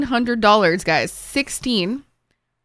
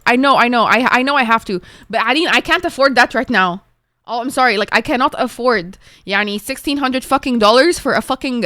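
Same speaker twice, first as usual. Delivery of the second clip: loud, stammering exasperation; very aggravated; 5 dB louder than usual. Real, not acted. Streamer telling chat she can't afford a crown, aggravated. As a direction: push back, getting worked up over the cost. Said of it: "I'm getting very aggravated".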